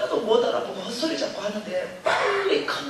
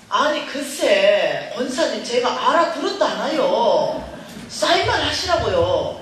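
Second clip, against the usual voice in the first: slow, louder, pleading through frustration; exasperated loud insisting; 6 dB louder, slower than usual. Retold or imitated. Daughter to mother, slow exasperated shout. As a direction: drag each word out, fed up.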